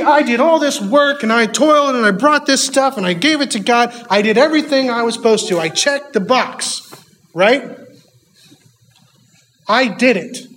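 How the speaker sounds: high and loud, indignant complaint; angry Cain. Acted. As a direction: rant like a wronged worker. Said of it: "He's, he's really mad".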